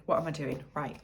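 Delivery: ordinary talk, nothing marked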